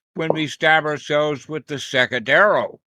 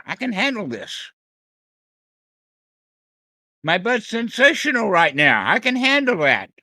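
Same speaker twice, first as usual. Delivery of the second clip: light, upbeat winner's voice, a touch higher; confident winner; 5 semitones higher than usual. Shown as a demonstration. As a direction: say it like someone who has already won. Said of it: "the attitude of a winner".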